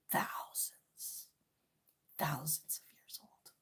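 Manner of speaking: a hushed, breathy repeat of the boast; conspiratorial whisper. Real, not acted. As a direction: whisper it like a secret. Whispered aside after retort.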